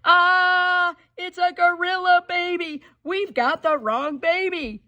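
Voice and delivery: high, loud alarmed shout; high panicked we've got the wrong baby; parents' yell. Acted. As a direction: scream at the gorilla baby. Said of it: "they both yelled".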